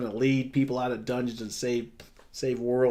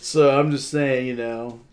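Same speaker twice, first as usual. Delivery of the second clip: ordinary delivery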